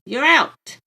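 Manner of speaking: high, loud joyful yell; gleeful shout. A narrator's reading. Boy catching the ball in a game, gleeful shout. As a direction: shout it out in play. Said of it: "shouting gleefully".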